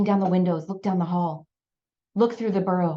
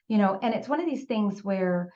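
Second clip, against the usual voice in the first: ordinary delivery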